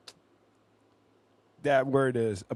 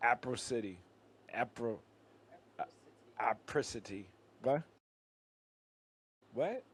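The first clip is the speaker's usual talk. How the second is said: normal talking voice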